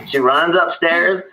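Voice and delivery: normal talking voice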